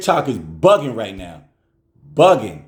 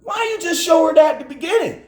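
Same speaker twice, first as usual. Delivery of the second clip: much higher, shouted exasperation; exasperated shout; 3 dB louder than usual. Retold or imitated. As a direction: yell at your daughter in disbelief. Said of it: "I was like".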